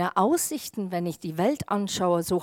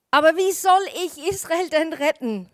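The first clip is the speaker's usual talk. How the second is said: louder, higher-pitched protest; called out; 6 semitones higher, 6 dB louder than usual. A narrator's reading. Gideon objects to saving Israel, calling out. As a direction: cry it out as an objection. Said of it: "rief Gideon".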